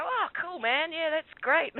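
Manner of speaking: mock-chummy, high-pitched approval; friendly cops. Cops hearing of the three-pointer, imitated friendly approval. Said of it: "really couldn't be friendlier".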